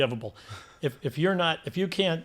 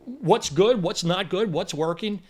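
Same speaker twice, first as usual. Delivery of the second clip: insistent boss; 7 semitones higher, 4 dB louder than usual. Retold or imitated. Enzo demanding driver feedback.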